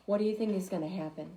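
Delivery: usual speaking voice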